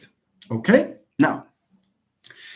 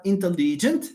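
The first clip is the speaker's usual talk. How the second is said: "intelligent" as in-te-LEE-gent; "intelligent" with a lengthened, stressed LEE; 3 semitones higher than usual. Shown as a demonstration. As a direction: lean on the third syllable LI of "intelligent". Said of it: "and stresses this".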